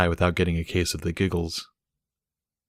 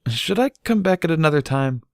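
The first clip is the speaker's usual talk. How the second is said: lightly voiced, higher and timid; sheepish; 10 semitones higher than usual. A narrator's reading. A hint of a sheepish colt at the door. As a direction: ask it sheepishly, backing away. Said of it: "said sheepishly".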